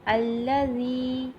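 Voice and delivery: الذی with long ee held; elongated ya. Shown as a demonstration. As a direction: draw out the ee of الذی. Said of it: "یہ یا مدہ ہو رہا ہے".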